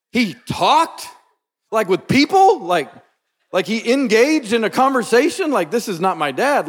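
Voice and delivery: high, rising, astonished questions; amazed disbelief, pitch jumping up; incredulous. Real, not acted. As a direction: marvel loudly as if it's unbelievable. Amazed that reserved dad talked.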